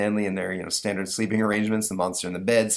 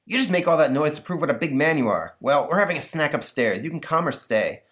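put-on higher, lisping kid voice; lisping boy Stanley, exasperated; 7 semitones higher, 3 dB louder than usual. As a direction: talk like an exasperated boy with a lisp.

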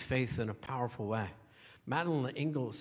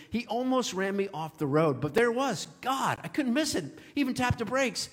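higher, amazed friend's retelling; excited retelling; 8 semitones higher than usual. Retold or imitated. Pastor voicing his friend on the phone, excited. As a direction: replay her astonishment, voice lifted high.

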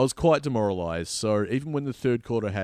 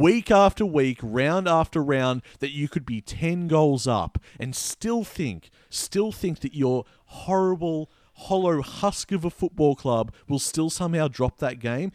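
louder, higher mock outrage; mock rant; 6 semitones higher, 3 dB louder than usual. Real, not acted. Fan ranting to co-host about a rival club, mock outraged. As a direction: ham up the disgust at the rival club.

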